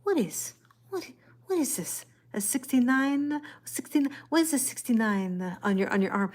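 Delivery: a high, puzzled Italian mother asking about the tattoo; a mother-in-law's Italian accent, high and confused. Retold or imitated. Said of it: "in her cute little Italian accent".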